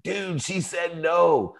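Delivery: high, slow, drawn-out mock yell of a kid; mock schoolboy yell. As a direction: call it out across the camp, hammed-up and slow. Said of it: "he screamed out in front of everyone".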